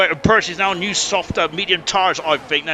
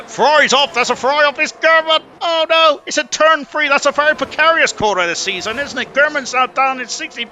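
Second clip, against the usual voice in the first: higher and louder, alarmed play-by-play; excited crash call; 8 semitones higher, 4 dB louder than usual. Real, not acted. Commentator reporting a Ferrari off at turn three, higher, louder and alarmed. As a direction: react live to a car going off, voice jumping up.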